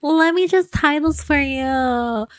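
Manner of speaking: put-on high, sugary doting girlfriend; sweet early-romance voice. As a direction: coo it like a new lover fussing over him. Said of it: "you know how in relationships, like".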